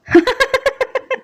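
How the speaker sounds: a soft, high chuckle; soft laugh. A narrator's reading. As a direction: let a quiet, amused laugh slip out. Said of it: "मध्यम सा कहका लगा उठा".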